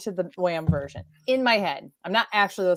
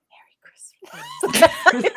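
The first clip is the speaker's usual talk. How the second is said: slow, drawn-out stage whisper; creepy whisper; 9 semitones higher, 4 dB louder, much slower than usual. Retold or imitated. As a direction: whisper it weirdly, stretched out and forceful. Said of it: "I whispered".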